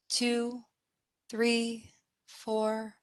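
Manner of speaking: soft, evenly spaced count held up in pitch; inhale count. Acted. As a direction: count them gently through breathing in. Counting the in-breath.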